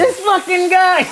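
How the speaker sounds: mock Italian exasperation; Italian accent. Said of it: "get my Italian accent going".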